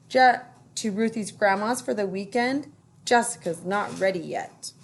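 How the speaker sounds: ordinary delivery, nothing marked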